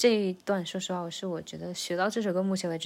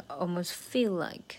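slow "almost feel like", "feel" prominent; stress on feel; slower than usual. Shown as a demonstration. Teacher marking the accent, stress on "feel". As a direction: pass over "almost" and land on "feel". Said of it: "这个feel".

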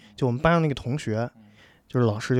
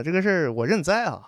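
ordinary delivery

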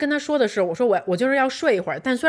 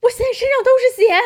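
very high, louder, fast alarmed wail; panicked cry; 14 semitones higher, 6 dB louder, faster than usual. Retold or imitated. Bleeding husband crying out in alarm, high pitched. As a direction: mimic his panic at the blood. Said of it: "他也特别慌".

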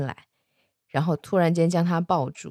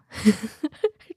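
higher-pitched giggle; amused laugh; 5 semitones higher than usual. Real, not acted. Narrator amused by an awkward question, giggling.

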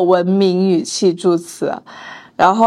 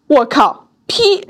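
shocked; 6 semitones higher, slower than usual. Retold or imitated. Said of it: "辅导员大惊".

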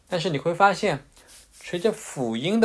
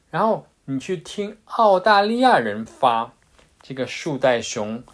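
ordinary talk, nothing marked

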